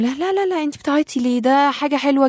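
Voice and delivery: imitating a pushy friend, chirpy and bright; mock cheerful reassurance. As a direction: brush off her tears with fake cheer. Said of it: "ونبتدي نقول".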